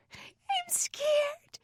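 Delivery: put-on very high, frightened little-girl wail; crying child. A narrator's reading. Sarah to her brother in the dark, crying out. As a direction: cry it out high like a scared child. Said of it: "Sarah cried".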